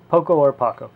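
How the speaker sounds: "Poco or Paco", contrasting OH with AH; two pronunciations. Shown as a demonstration. Reviewer unsure of the name, giving "Poco or Paco" as POH-koh or PAH-koh. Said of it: "which way you wanna pronounce it".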